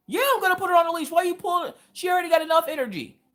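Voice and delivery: high, fast mock grandpa voice; imitating grandpa. Grandpa defending the leash to his daughter, high mock voice. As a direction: put on a high hurried old grandpa. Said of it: "my dad call me ... and go".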